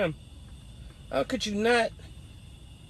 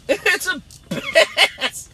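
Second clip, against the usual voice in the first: laughing; 10 semitones higher, 6 dB louder, much slower than usual. Real, not acted. Repeats drawn out laughing.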